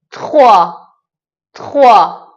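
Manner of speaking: تخوا with a quick light t and French throat r; touch the t, roll into khwa; French trois. Shown as a demonstration. Teacher demonstrating French trois to learners. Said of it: "نکته که داره اینجا".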